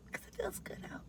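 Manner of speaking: soft whisper. Real, not acted. Whispering about the weather outside. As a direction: breathe it out.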